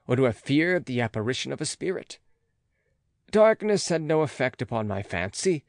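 ordinary delivery

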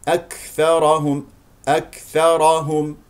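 slow أكثرهم with a puff of breath after K; whispered K release. Shown as a demonstration. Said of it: "كاف ساكنة فيها همس". (أكثرهم بكاف فيها همس)

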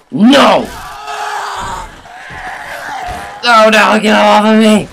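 high, loud panicked yell; panicked scream. Real, not acted. Gamer grabbed by zombies, panicked high scream. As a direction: scream as they grab you.

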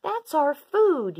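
high, loud, slow protest; yelling. A narrator's reading. Apatosaur protesting over stolen food, yelling. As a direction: yell it indignantly, stretching the words. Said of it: "yelled Apatosaur".

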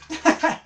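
burst of laughter. Real, not acted. Laughs at the infestation.

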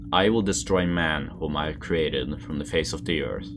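usual speaking voice

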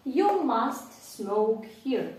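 very slow, every word separate; slow drill. Shown as a demonstration. Teacher drills the affirmative sentence very slowly. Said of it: "попробуйте утворити стверджувальне".

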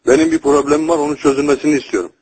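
normal talking voice

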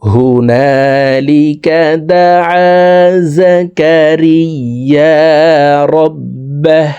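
slow, drawn-out chanted recitation; Quran recitation. Shown as a demonstration. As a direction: intone it slowly like tilawat. Teacher recites the verse. (ধীর সুরেলা তিলাওয়াত)

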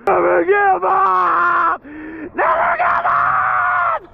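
slow, shouted rallying cry; triumphant cry. Real, not acted. Angler to viewers after the catch, shouting in triumph. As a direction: chant it like a victory cry.

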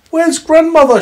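put-on very high, loud girl voice; little girl asking. Rescued girl asking after Granny, high loud voice. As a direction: pipe up high like a small child.

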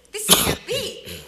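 slow, high-pitched disbelief; retold disbelief. Reporter thinking about her stories, in high disbelief. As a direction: lift it high and stretch it out.

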